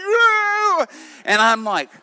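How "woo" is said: very high, loud imitation whoop; wild whoop. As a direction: mimic a madwoman's wild shrieking whoop. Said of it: "She's like".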